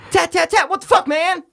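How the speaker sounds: high shouted movie line; movie character shout. Retold or imitated. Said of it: "And you're like".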